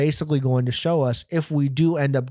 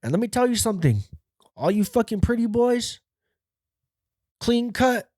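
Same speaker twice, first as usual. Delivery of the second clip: high-pitched hectoring inmate; put-on high inmate voice with pauses; yelling inmate; 10 semitones higher than usual. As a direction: imitate an inmate lecturing boys. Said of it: "And he goes".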